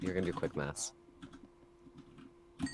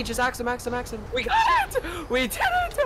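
high, loud victory yells; excited shouting; 12 semitones higher, 3 dB louder than usual. Real, not acted. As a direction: shout it as the boss dies. Calling out at boss kill.

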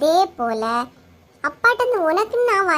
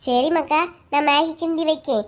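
ordinary delivery

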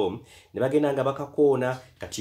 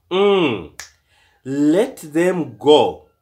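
loud and slow; 6 semitones higher, 8 dB louder, much slower than usual. A narrator's reading. Friend calling out to dad.

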